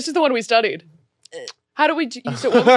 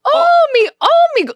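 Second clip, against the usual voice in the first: high-pitched mock-Irish exclaiming; hammed-up, higher Irish voice; Irish accent; 9 semitones higher than usual. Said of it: "Um, Irish".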